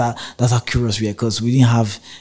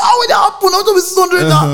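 outraged shout; 7 dB louder than usual. Retold or imitated. Arguing with bus conductor.

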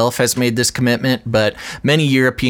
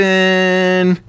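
excited reveal; 9 semitones higher than usual. Real, not acted. Dramatic reveal of new kitten.

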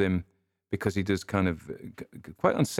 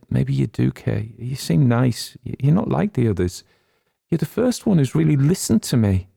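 lightly voiced, higher, softening towards trust; a hint of a fragile young woman opening up; Molly, tearful young woman; 5 semitones higher, 5 dB louder than usual. A narrator's reading.

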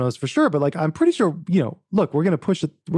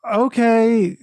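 mock stunned; 7 semitones higher, much slower than usual. Retells his stunned reply.

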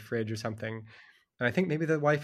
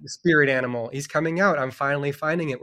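higher and louder, thrilled to find it; excited discovery; 5 semitones higher, 5 dB louder than usual. Retold or imitated. Author recalling his creative breakthrough, excited and louder. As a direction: greet your inner spirit animal with glee.

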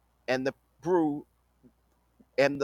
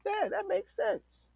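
high voice; 6 dB quieter than usual. Retold or imitated. Retold own reaction.

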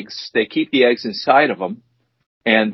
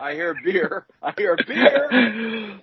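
gleeful singsong; 8 semitones higher, 3 dB quieter, much slower than usual. Pretend. Gleeful singsong about beer.

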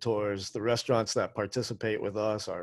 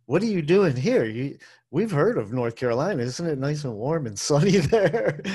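louder, higher mock astonishment, own accent; incredulous Scots, retold; 4 semitones higher, 6 dB louder than usual. Scottish people questioning a visitor from North Carolina, mock incredulous. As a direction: imitate baffled locals, loud and high, laughing at the end. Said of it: "all the Scottish people were like".

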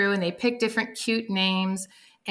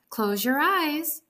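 sweet teacher voice; 6 semitones higher, slower than usual. Acted. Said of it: "make Miss Day's voice so sweet".

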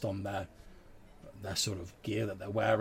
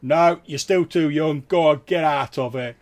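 gruff goat man shouting him away; 6 semitones higher, 13 dB louder than usual. Acted. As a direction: yell the boy out of the inn. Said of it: "the voice of, like, the goat man proprietor from inside going".

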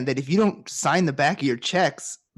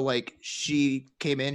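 ordinary delivery

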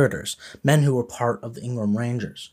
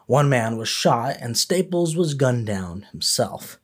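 ordinary talk, nothing marked

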